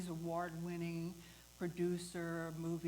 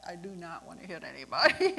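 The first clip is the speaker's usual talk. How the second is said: nervous outburst; 2 semitones lower, 12 dB louder than usual. Retold or imitated. Scared of pushing the car backward.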